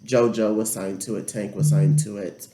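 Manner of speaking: usual speaking voice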